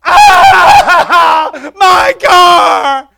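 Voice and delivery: hammed-up high wailing shout; drama queen. Owner mourning his scratched car, melodramatic wailing. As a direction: wail over the car like it's a tragedy. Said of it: "he is such a drama queen".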